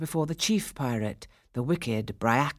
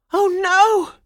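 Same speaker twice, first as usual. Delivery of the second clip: high, loud cry of alarm; dismayed cry; 10 dB louder than usual. A narrator's reading. Girl blocked by two pirates, crying out high in dismay. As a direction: cry out as the escape fails. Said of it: "she cried".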